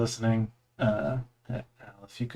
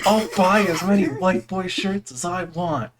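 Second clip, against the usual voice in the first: mock defiant; 8 semitones higher than usual. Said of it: "He said".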